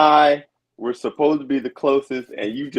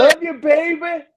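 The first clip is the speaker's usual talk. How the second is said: mock love call; 14 semitones higher than usual. Mocking shoutout to Jenny.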